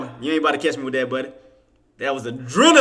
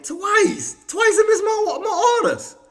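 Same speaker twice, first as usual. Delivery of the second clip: much higher disbelieving complaint; incredulous laughing; 13 semitones higher than usual. Real, not acted. To viewers after missing orders twice, incredulous laughing.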